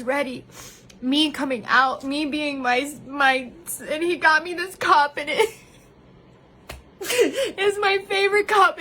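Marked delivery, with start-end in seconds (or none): 5.3-8.8 higher, half laugh half cry; giggling while teary; laughing through tears. Real, not acted. Vlogger shows the cup her husband gave her, laughing through tears. Laughing and crying about a gift.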